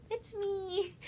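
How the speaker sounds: high, drawn-up mock announcement; high-pitched mock reveal. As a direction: pitch it way up, imitating him. Retold goofy flirty bedroom reveal.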